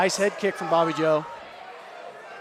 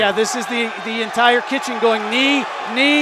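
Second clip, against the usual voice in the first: shouting the finish; 3 semitones higher, 6 dB louder than usual. Real, not acted. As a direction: yell it as the fight ends. Calling the finishing knees.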